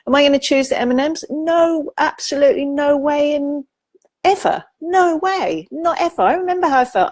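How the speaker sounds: high, insistent no way, not ever; emphatic refusal. Acted. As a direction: push every no up high with conviction.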